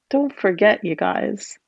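ordinary delivery, nothing marked

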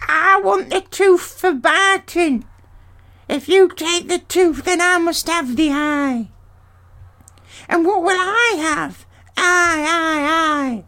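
very high, thin crone voice, greedy and whining; a grasping ancient hag fighting over the eye; squabbling old hag. Acted. Grey sister squabbles with her sisters over the tooth, high hag voice.

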